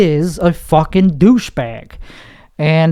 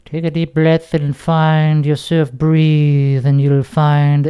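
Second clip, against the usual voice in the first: lightly voiced, slow meditation guide; guided meditation guru; slower than usual. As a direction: ease them into breathing like a life coach. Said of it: "my guru voice".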